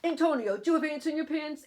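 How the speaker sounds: exasperated uncle voice; scolding uncle. Acted. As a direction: chide a fidgety child loudly. Uncle scolds fidgeting nephew.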